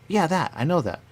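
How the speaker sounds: mock-excited quote; eager recognition. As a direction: blurt it out like you just got it. Quoting players recognizing something.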